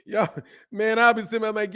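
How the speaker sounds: ordinary talk, nothing marked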